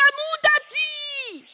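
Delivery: high, drawn-out loud call. Acted. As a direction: call her name high and long. Husband calls out to her.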